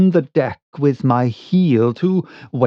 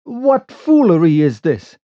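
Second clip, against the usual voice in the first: raised, louder challenge; calling out; 4 semitones higher, 5 dB louder than usual. Acted. Narrator challenges the unseen boat, calling out. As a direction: demand it of someone out on the water. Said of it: "I called".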